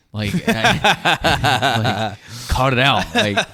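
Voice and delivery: laughing through the words. Real, not acted. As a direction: giggle while scolding. Laughing while retelling scolding.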